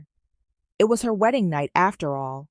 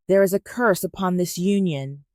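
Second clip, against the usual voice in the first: ordinary delivery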